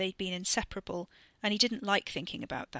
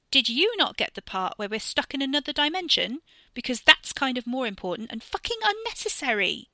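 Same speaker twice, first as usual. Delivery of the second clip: angry, raised yell at her brother; 5 semitones higher, 7 dB louder than usual. Acted. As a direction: let him have it, loud and furious. Catherine yelling at her brother.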